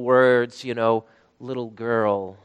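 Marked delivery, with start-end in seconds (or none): 1.4-2.4 soft, low and gentle; tender. Acted. Jesus waking the dead girl, soft and tender. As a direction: wake her as gently as a father would. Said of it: "it's so tender".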